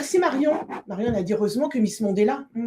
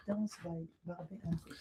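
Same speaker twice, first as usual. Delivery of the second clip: whisper; 4 semitones lower, 16 dB quieter than usual. Real, not acted. Repeating the title, whispered to the audience.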